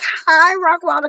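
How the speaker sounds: high, timid mock greeting; nervous hello. Greeting famous producer Rock Wilder on the phone, timid and high. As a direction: squeak it out like a starstruck fan. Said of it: "'cause I'm scared".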